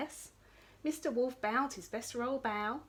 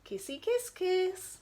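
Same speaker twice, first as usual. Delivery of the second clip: high, slow smooch; slow kissy sound; 10 semitones higher, slower than usual. Acted. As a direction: stretch the kiss out. Kiss sound effect.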